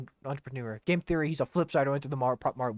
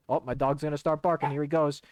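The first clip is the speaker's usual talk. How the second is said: ordinary talk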